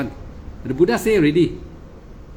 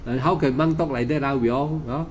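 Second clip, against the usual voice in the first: usual speaking voice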